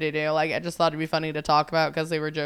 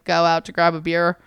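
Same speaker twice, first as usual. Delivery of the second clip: ordinary talk, nothing marked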